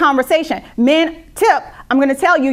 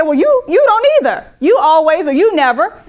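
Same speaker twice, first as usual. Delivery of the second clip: high, louder defensive comeback; defensive retort; 4 semitones higher, 3 dB louder than usual. Retold or imitated. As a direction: throw the blame right back. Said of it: "I'm gonna say".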